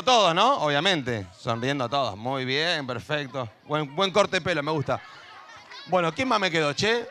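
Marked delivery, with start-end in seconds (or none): none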